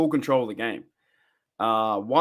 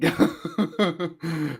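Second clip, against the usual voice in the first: higher-pitched run of chuckles; laughing; 4 semitones higher than usual. Real, not acted. Host tickled by their own spelling of Sega, helpless chuckling. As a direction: break into laughter halfway through spelling.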